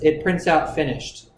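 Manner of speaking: ordinary talk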